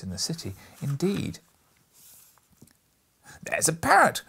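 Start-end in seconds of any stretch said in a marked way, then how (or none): 3.4-4.2 high, loud delighted shout; excited cry. A narrator's reading. Scrooge cries out at the parrot, thrilled. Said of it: "cried Scrooge".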